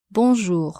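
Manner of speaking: "Bonjour" with nasal "on", no N sound; nasal vowel. Shown as a demonstration. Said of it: "Be careful with the pronunciation of the nasal vowel".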